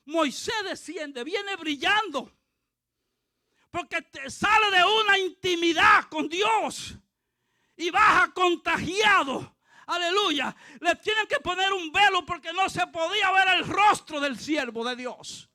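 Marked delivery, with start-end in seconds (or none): 3.7-15.5 loud, raised-pitch pulpit delivery; full-force preaching. Real, not acted. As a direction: preach it out to the whole congregation.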